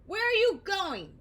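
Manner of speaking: high, loud call; Squirmy calling after flying Oscar. Acted. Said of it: "Squirmy called after him".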